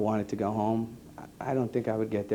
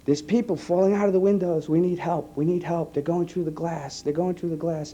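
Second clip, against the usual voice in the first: retold yelling; 6 semitones higher than usual. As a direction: relay the lieutenant's panic from the scene. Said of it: "was yelling".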